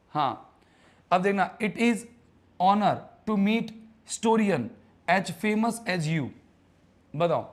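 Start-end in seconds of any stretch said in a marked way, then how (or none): 1.6-6.3 sentence read out very slowly, word by word; slow reading aloud. Shown as a demonstration. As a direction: spell it out slowly for the class. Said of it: "pronunciation कर लो दादा यार".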